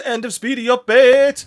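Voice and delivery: usual speaking voice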